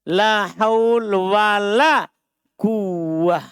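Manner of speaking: very slow, chanted Arabic phrase; slow recitation. Shown as a demonstration. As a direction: draw out la haul wala quwwah. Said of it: "Misal kalimat yang sering ucapkan".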